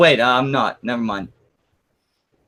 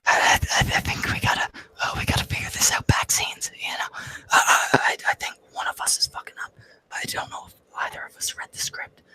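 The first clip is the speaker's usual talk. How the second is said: whispered, hushed aside to his rival; backstage whisper; 9 dB quieter than usual. Pretend. Wrestler whispering to his opponent mid-match, hushed. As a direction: whisper it so the crowd can't hear. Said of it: "Then you can kinda whisper at me".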